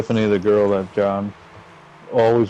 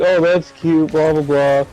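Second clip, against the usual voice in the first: higher, louder sneering imitation; mock condescension; 7 semitones higher, 5 dB louder, slower than usual. Girlfriend belittling the objection, louder mocking imitation. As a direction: mimic her belittling reply. Mimicking her condescending reply.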